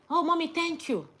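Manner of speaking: put-on a happy kid thanking mum; grateful child.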